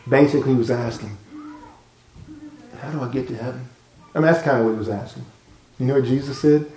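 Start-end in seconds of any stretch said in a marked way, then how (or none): 2.8-3.6 hushed, much softer secret ask; quiet question between two men; secretive. Retold or imitated. Secret night visit, hiding from others.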